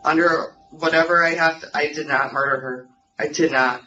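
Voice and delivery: ordinary delivery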